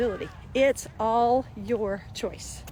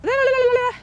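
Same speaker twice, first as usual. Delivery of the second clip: much higher, louder whiny babble; mock toddler tantrum; 9 dB louder than usual. Parent mimicking a screaming little kid, shrill babble. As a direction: ham up a wailing kid.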